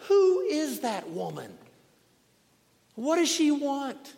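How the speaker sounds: high-pitched, fed-up demand; exasperated judge. Acted. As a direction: ask like a judge at the end of his patience. Exasperated judge after hours of yelling.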